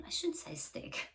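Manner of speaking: whispered aside to herself; real whisper. Real, not acted. Storyteller catching her own wording, whispering. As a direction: drop to a whisper, a little embarrassed.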